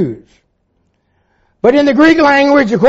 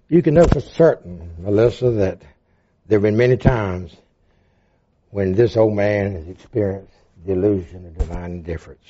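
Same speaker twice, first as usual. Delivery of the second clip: softer, lower, intimate telling of his own life; quiet testimony; 10 semitones lower, 5 dB quieter than usual. Real, not acted. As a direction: speak it gently, like a confession to friends.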